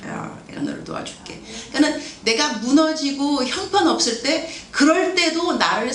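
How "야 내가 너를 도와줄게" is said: tender God. Retold or imitated. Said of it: "이러는 분은 또 아니세요".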